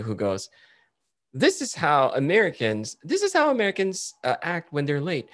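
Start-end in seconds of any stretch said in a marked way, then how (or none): 1.3-5.3 high-pitched retold skit intro, Americans' turn; imitated TikToker on Americans. TikToker showing how Americans act when late, high mock voice. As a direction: present the Americans in a raised skit voice. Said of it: "there's this TikToker who go".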